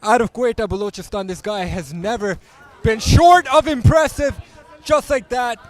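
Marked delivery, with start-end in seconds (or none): 2.8-5.6 high, loud excited call; excited shout. Real, not acted. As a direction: yell it as the fighter lands. Excited fight commentary.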